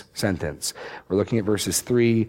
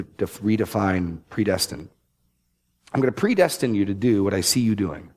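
ordinary talk, nothing marked

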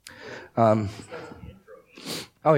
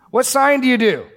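much higher, loud mock demand; shrill the demand like a pushy heckler; mock demanding crowd; 14 semitones higher, 7 dB louder than usual. Crowd heckling Jesus for a sign, high loud mockery.

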